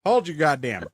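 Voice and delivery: loud, high exasperated outburst; exasperated interruption. Real, not acted. Cutting in over friend's retelling.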